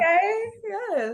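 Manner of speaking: high excited squeal; hyped up for his mixtape. Real, not acted. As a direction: shriek approval up high. Excited reaction.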